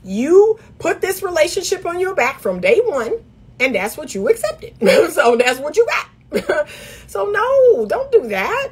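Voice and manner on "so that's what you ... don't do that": laughing. Real, not acted. Laughs while scolding.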